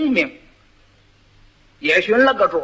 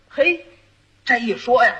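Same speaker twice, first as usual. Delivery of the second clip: ordinary talk, nothing marked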